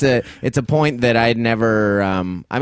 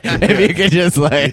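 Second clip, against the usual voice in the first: laughing; 7 semitones higher, 5 dB louder than usual. Real, not acted. Laughing at own joke.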